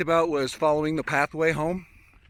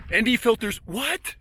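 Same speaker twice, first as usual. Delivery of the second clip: higher-pitched, incredulous "what?"; mock bewilderment; 7 semitones higher than usual. Vlogger joking about his ND filters video, mock bewilderment. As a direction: query it in rising mock disbelief.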